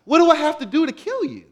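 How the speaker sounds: loud, high-pitched frustrated outburst; exasperated Satan. Acted. Satan to Paul who won't die, loudly exasperated. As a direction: act out Satan at his wit's end, voice climbing high. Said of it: "Satan was probably like".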